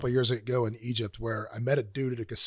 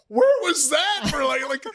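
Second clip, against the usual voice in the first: much higher, slow, disbelieving shout; incredulous outburst; 12 semitones higher, 5 dB louder, slower than usual. Retold or imitated. Retelling his reaction to the producer's film fund, a slow high incredulous shout. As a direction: stretch it out, floored. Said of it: "I'm like".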